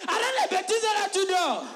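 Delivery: loud scolding, high; scolding angel. Retold or imitated. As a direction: tell him off sharply. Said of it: "qu'il demande".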